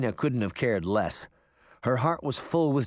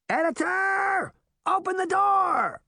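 drawn-out, high-pitched call; full shout, very high and stretched; shouting over noise; 3 dB louder than usual. A narrator's reading. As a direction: yell for someone far off over a din. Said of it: "Puck shouted over the noise".

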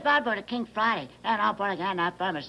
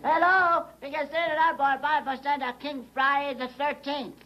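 calling into telephone; 10 semitones higher than usual. Acted. Troll calling into sky telephone.

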